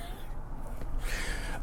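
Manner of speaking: soft, voiceless chuckle; low-volume real chuckle; quiet laugh. Real, not acted. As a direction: laugh softly at your own joke. Laughing at own floating-head joke.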